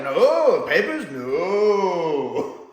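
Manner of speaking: loud, slow, drawn-out "papers, no". Retold or imitated. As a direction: stretch "papers, no" out slowly like a Scottish lad catching on. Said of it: "I love the way Frasier does that".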